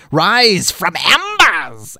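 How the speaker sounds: slow theatrical proclamation, not quite Skeletor; failed Skeletor attempt. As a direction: announce it like a hammy cartoon villain. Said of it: "more Skeletor-like".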